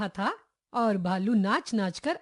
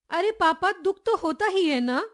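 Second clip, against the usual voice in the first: put-on higher-pitched, mildly pleading little girl; a touch of a child's plaint to Papa; tearful protest; 7 semitones higher than usual. A narrator's reading. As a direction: protest in a high, upset child voice. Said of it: "नन्ही ने रोनी सूरत बनाकर कहा".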